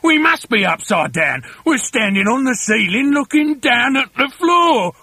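rising into a high, panicky cry; astounded husband; aghast exclamation. A narrator's reading. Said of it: "gasped Mister Twit".

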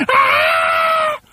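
loud startled scream. A narrator's reading. Wife feels something slimy in bed, loud scream. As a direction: cry out in fright. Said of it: "She screamed again".

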